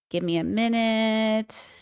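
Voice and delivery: slow and drawn out. Real, not acted. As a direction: stretch it, distracted.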